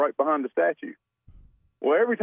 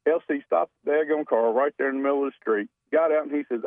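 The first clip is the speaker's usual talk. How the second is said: ordinary talk, nothing marked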